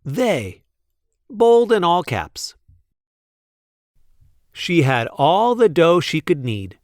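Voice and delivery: usual speaking voice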